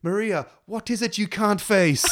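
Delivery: high, loud nun imitation; hammed-up matron from a musical. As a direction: sing out the question like a stern matron nun. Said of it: "one of the matron nuns saying to Maria".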